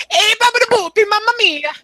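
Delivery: very high, loud cartoon Italian squeal; mock Italian. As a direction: shriek gibberish like a caricature Italian. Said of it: "I just learned Italian".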